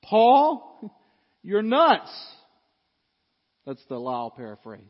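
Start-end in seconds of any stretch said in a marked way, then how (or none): 0.0-2.1 Paul's name blasted high and loud; loud exclaim with a laugh; loud exclamation. Acted. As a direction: shout it at Paul in disbelief. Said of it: "Festus exclaimed in a loud voice".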